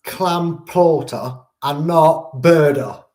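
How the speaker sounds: clam plorter and not birder, slow and careful, joke stand-ins for manslaughter and murder; spoonerised crime words. Shown as a demonstration. Dodging YouTube flags, slowly saying clam plorter and not birder. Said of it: "I'm gonna say a word that sounds like it".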